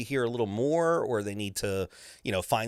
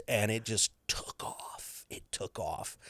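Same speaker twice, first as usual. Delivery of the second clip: hushed, soft aside; whispered, almost to yourself; 2 semitones lower, 8 dB quieter, slower than usual. Real, not acted. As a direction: drop to a whisper for the punchline.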